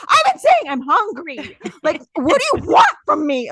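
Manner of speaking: very high, loud exasperated mock yell; irritated outburst. Hungry wife answering clueless husband, exasperated mock yell. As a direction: wail it at the top of your range, acting fed up. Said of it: "I was so irritated".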